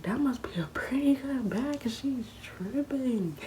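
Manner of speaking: high, slow whispery mock; amused family. Family joking about the strong bag, high and slow. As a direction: tease her for tripping. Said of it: "they was like".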